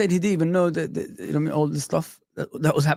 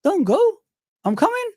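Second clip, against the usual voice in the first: much higher squeaky disbelief; mock bewildered; 14 semitones higher than usual. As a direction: play it as stunned confusion. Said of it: "He said".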